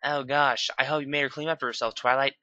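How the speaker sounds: mild mock horror. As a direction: gasp at it, quick. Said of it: "Rarity said in horror".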